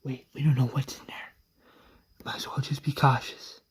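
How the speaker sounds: hushed, fast whisper; sneaky warning whisper; cautious whisper. Retold or imitated. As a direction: whisper it so nothing inside hears. Sneaking into church, hushing group.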